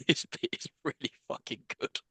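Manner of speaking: whispered through laughter; breathless laugh. Real, not acted. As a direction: squeeze it out laughing. Laughing hard at the joke.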